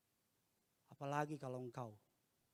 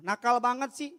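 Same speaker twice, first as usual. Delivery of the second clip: put-on loud, high-pitched scolding of a child; exasperated wife; 10 semitones higher, 13 dB louder than usual. Wife scolding their lively child, exasperated. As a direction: imitate an exasperated mother raising her voice. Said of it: "istri saya kadang-kadang suka tergoda bilang".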